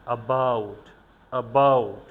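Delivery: weak "uh", loud strong "bout" in "about"; stress on second syllable. Shown as a demonstration. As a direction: punch the end of "about". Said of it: "উইক সিলেবলে উচ্চারণ করেছি". ("অ্যাবাউট"-এ "অ্যা" হালকা, "bout" জোরে)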